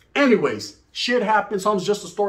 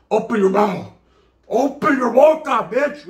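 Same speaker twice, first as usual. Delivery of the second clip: raised, higher, slow, menacing demand; mock tough-guy threat; 6 semitones higher, 6 dB louder, slower than usual. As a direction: bark it at the guy you're spraying. Acted threat during a street fight.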